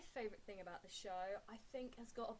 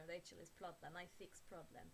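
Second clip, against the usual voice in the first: a lightly done, quieter bad Ukrainian accent; 3 semitones lower, 6 dB quieter than usual. Vlogger imitating Helena in a bad Ukrainian accent. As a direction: mangle it into a Ukrainian accent, half-hearted. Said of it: "my very bad Ukrainian accent".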